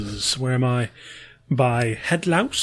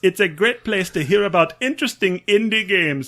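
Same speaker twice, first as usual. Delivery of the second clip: attempted Transylvanian vampire accent, sounding Jamaican; botched, lilting Transylvanian accent; the Count; 3 semitones higher than usual. Reading a listener's review as the Count in a botched Transylvanian accent. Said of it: "That is the Count in my head".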